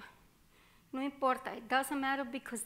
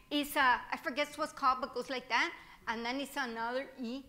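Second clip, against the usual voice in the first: ordinary talk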